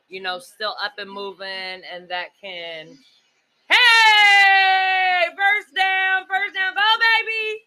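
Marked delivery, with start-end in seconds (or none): 3.7-7.6 high shouted cheer. Real, not acted. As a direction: yell for the first down. Cheering football play on TV.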